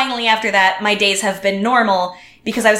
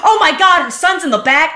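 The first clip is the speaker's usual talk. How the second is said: mock alarm; 6 semitones higher than usual. Acting out the alarmed police officer.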